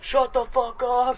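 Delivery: high, loud, slow mock yell; hammed-up furious shout; mock angry shout. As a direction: bark it at them, dragged out. Everyone else snapping back.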